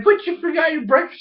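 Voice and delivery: high-pitched mock concern; girlish calling after. Ikki calling after the fleeing squirrel, high girlish voice.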